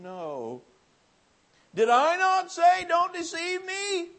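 high, loud, slow preaching; preaching at full force. Acted. Preacher crying out the mother's words to the revival crowd. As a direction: cry out her words to the crowd.

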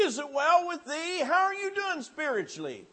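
high, loud preached questions; preaching at full force. Acted. Preacher pressing the revival crowd with loud high questions.

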